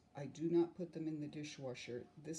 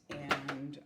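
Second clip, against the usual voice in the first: usual speaking voice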